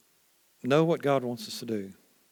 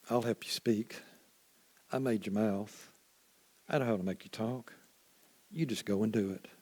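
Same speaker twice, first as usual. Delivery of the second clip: softer, calm and patient; gentle reassurance; 2 semitones lower, 7 dB quieter than usual. Acted. God gently reassures doubting Moses, softly and calmly. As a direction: soothe him like a patient father.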